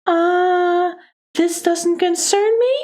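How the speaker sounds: high-pitched, arrogant brush-off; arrogant lady. Acted. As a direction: dismiss him in a haughty high voice. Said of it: "arrogant voice, 'cause now she doesn't like me".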